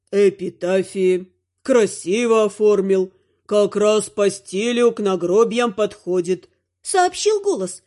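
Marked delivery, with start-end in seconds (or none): 0.1-6.5 deep, slow ghostly calm; low ghost voice. Acted. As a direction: speak like a calm old ghost. Ghost replies. (низкий голос призрака)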